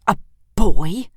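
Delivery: loud, incredulous queen; surprised queen. Acted. The Queen startled that Edmund is a boy, loud and incredulous. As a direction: bark the question in disbelief.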